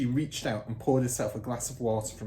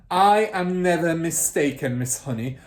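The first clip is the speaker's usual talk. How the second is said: loud, higher domineering boom; booming certainty; 6 semitones higher, 9 dB louder than usual. Acted. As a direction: declare it as final. Trunchbull voiced.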